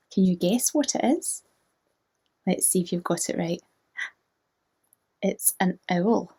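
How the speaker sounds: usual speaking voice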